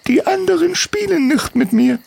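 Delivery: put-on very high child's voice, downcast; a hurt kid telling her story; sad little girl.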